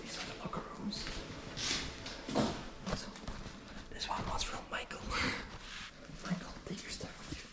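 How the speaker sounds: faint breathy whisper; nervous night vlog whisper. Real, not acted. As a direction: keep it to a whisper. Whispering inside factory.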